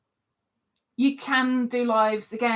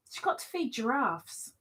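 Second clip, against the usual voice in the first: ordinary talk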